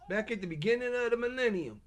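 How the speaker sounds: ordinary talk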